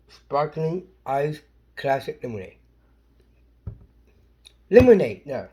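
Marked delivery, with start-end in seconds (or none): 4.7-5.2 shouting the word; shouted. Real, not acted. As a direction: call it out loud. Calling out the word loudly.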